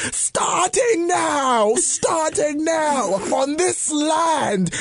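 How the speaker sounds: slow, high, dramatic proclamation; Cicely Tyson speech. Retold or imitated. Comedian imitating Cicely Tyson's big speech, slow and grand. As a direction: draw out every word like a grand stage speech. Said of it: "She was like".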